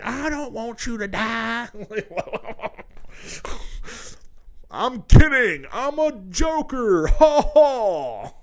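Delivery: high, slow put-on goofy voice; a hammed-up awkward clown; mock goofy joker. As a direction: ham up a nervous joker laughing it off.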